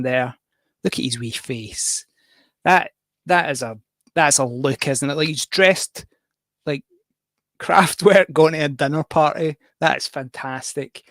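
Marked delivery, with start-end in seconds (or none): none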